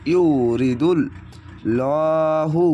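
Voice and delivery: chanted ইউরিদুল in reciting voice; recited word. Shown as a demonstration. Teacher repeating the word in recitation voice. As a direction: recite it, not speak it. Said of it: "এক আলিফ টেনে পড়বেন".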